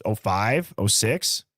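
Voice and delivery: ordinary delivery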